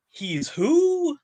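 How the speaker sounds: high, loud stunned disbelief; shrieking "he's who?" in shock. Acted. Acting out Empire twist reveal.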